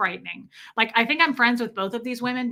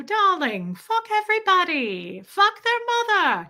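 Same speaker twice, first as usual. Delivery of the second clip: high, posh British darling; classy British; 9 semitones higher, 3 dB louder than usual. Shown as a demonstration. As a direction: make it sound grand and British, high. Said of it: "if you say it in a classy way".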